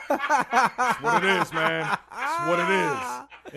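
high, very slow, stretched-out shout; loud proclaiming. Real, not acted. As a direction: holler it out drawn-out through laughter. Laughing, loud drawn-out proclamation to the room.

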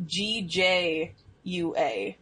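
each letter of G J U A slow and separate; slow spelling. Shown as a demonstration. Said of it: "was it G O O J A?".

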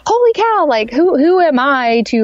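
high, humbled disbelief; retold amazement. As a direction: act out being floored, pitch shooting up. Retold amazement at others' stories.

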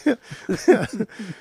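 a short high burst of laughter. Real, not acted. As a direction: laugh at the silly song. Laughing at the song.

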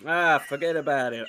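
playful mock New York accent on forget about it. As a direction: toss it off like a New York wiseguy. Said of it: "Oh, that's New York".

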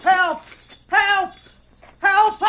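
high, loud separate cries for help; short, urgent high-pitched yells; yelling for help. Acted. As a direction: shout down to someone far below. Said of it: "yell down to them".

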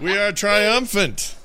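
slow, high, loud proclamation; triumphant declaration. Real, not acted. Player hails the party's win, slow triumphant cry. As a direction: declare victory with relish.

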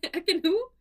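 high echo of the confused Ekin who; mock confusion. Podcast host echoes co-host, mock puzzled. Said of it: "who was the other".

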